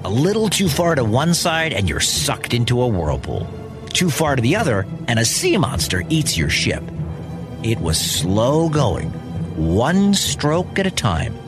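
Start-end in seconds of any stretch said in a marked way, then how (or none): none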